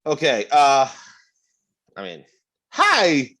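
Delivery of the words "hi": bad Walken impression. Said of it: "that's the worst Walken ever".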